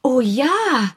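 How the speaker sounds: high, bright-eyed exclaiming; a lightly voiced lady's eager cry; eager exclamation. A narrator's reading. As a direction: burst out in eager agreement. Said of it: "rief Misses Bantry".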